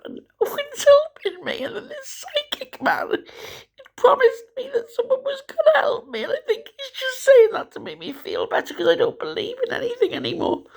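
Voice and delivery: breaking down and crying through the words; real tearful despair. Real, not acted. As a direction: voice cracks up high into tears. Breaks down over having no help.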